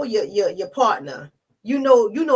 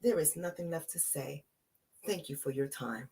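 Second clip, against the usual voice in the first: cool dismissal; 5 semitones lower, 15 dB quieter than usual. Retold or imitated. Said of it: "the way I said".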